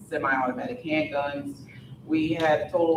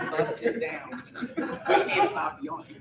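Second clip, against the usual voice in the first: laughing; 11 semitones higher than usual. Real, not acted. Tired speaker laughing at mic.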